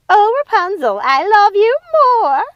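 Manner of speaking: high loud hammed-up mother voice; mock sweet mother voice; mocking Gothel. A narrator's reading. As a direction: gush it like a fake mother. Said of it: "mocked Martha".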